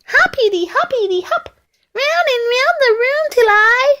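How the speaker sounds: high, bouncy bunny voice; excited little bunny. Acted. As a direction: chirp it while hopping round and round. Bunny hopping round the room.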